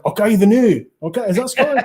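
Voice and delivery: high-pitched, hammed-up Scottish attempt; mock-clueless stab at a Scottish accent; fake Scottish accent. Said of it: "Oh, do a Scottish one".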